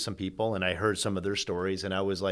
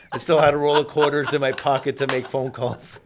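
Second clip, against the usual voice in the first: laughing joke; 2 semitones higher, 7 dB louder than usual. Pretend.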